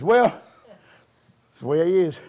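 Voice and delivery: ordinary talk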